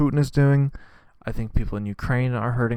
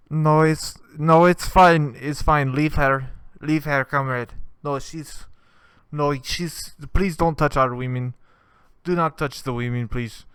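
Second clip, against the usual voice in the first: mock Russian accent; 4 semitones higher, 4 dB louder, slower than usual. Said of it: "one guy go".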